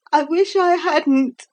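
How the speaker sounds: put-on high, upset child's lament; near tears. A narrator's reading. Philip after Helen vanishes, close to crying. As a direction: wail it lightly, pitched well up. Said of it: "his mouth trembled as girls' mouths do".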